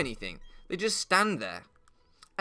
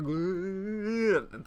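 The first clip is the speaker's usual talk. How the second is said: drawn out, slow, with a noisy sound; slow sound effect; 5 semitones higher than usual. Shown as a demonstration. Retelling the giraffe pulling out, slow sound effect. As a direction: stretch it out slowly like a sound effect. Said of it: "important you get the noises right".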